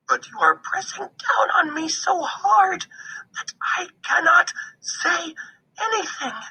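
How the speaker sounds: high, strained little squirrel voice; squeezed squirrel. A narrator's reading. Squirrel crushed under paw, begging for breath. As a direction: squeak it out as if crushed.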